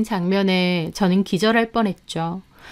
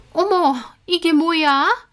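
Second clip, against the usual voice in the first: high, drawn-out startled question; very surprised; 8 semitones higher, slower than usual. A narrator's reading. Housekeeper sees herself on screen, startled. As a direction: gasp it out slowly, pitched up. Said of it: "송혜연도 몹시 놀라더라고요".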